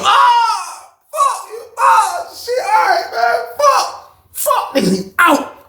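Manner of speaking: very high cries and shouts; pained shouting. Retold or imitated. Men fighting behind the door, pained shouting. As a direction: yell through a beating.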